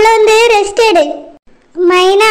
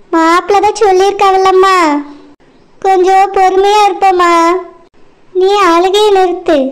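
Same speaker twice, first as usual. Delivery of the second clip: ordinary talk, nothing marked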